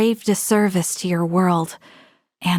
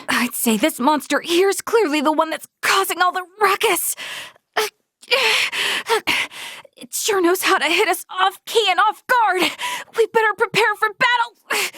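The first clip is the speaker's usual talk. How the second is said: higher, strange voice sizing up a monster; an eager, higher, unfamiliar voice readying for a fight; odd high voice; 11 semitones higher than usual. Acted. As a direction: talk like something else has taken over. Voice unlike her own, much higher.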